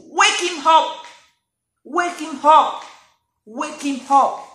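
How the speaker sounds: loud, high preaching cry; urgent exhortation. Real, not acted. Preacher urging listeners to wake Jesus, loud and high. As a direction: drive the call home. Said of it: "urging the congregation".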